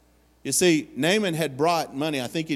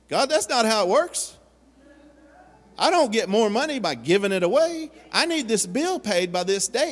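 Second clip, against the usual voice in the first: incredulous protest; 7 semitones higher than usual. Acted. Believer protesting to God, incredulous. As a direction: argue back at God in disbelief.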